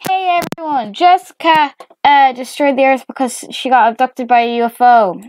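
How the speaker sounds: high, loud dad announcement; calling out news. Acted. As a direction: call it out to a whole crowd.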